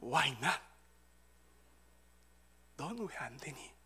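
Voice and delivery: low, softened divine reply; God's answer. Acted. God answering the congregation's question, soft low voice. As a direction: answer gently, with a long pause between phrases. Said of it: "하나님께서는 반드시 이렇게 대답해 주실 것입니다".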